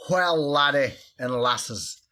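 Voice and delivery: over-the-top Scottish brogue, loud and showy; Scottish accent. Pretend. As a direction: play a boisterous Scottish host rallying the wee ones. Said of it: "in his best Scottish accent".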